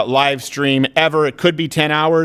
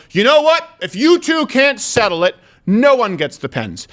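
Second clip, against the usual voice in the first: scolding teacher; 7 semitones higher than usual. Retold or imitated. Teacher ruling on two boys' pen dispute, exasperated mock scolding. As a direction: imitate a fed-up teacher laying down a ruling. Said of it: "And the teacher goes".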